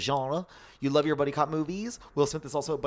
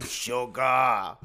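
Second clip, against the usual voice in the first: loud, drawn-out growl of a word; film character impression; 5 dB louder than usual. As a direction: imitate the movie character, loudly. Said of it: "he's just like".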